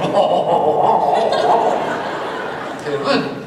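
taunting bar crowd oh-oh-oh, laughing; mocking oh-oh laugh. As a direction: needle the American with a crowd's mock laugh. Bar guys jeering at him.

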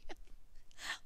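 a soft breathy giggle; quiet real giggle. Real, not acted. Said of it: "I don't know why I'm giggling".

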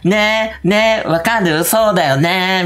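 high, drawn-out pleading for agreement; Ryota. Retold or imitated. Streamer urging everyone to agree, high insistent impression. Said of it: "で、りょうたもね、".

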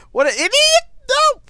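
high, loud outburst; exasperated yell. Real, not acted. Player reacting to a game death, yelling. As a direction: cry out high, loud at a blunder.

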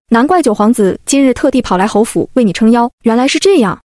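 ordinary delivery

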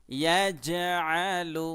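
ইয়াজআলু intoned slowly with a bounce on জ; qalqala demo. Shown as a demonstration. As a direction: recite ইয়াজআলু with a push on জ. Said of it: "ধাক্কা দিয়ে".

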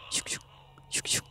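whispered sneaking sound; whispering. Real, not acted. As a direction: tiptoe it in a whisper. Sneaking past monster. (속삭이듯 슉슉)

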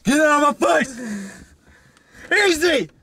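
shrieking at the other drivers, high and slow; high furious yell behind the wheel; road rage. Acted. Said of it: "it's my road rage".

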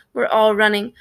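usual speaking voice

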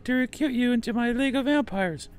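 high, far-off put-on vampire voice; vampire character voice. Gamer voicing a vampire character, high put-on voice.

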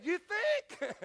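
high, loud mock-sarcastic comeback; sarcastic retort. Husband answering his wife's obvious shout, sarcastic. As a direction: toss it back with dry sarcasm. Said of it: "I said".

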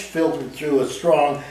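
plain reading voice

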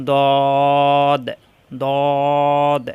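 "দদ" thick, long and level; tongue edge on upper molars for a heavy, sustained "দদ"; heavy articulation. Shown as a demonstration. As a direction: hold a full rounded vowel in "দদ". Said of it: "মোটা করে উচ্চারণ".

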